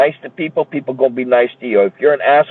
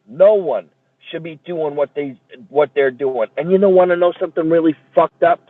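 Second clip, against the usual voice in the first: ordinary delivery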